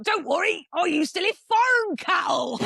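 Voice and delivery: high, hammed-up mock shout of reassurance; imitating the undertaker. Teller mimics the undertaker reassuring her, high mock shout. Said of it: "And he was like".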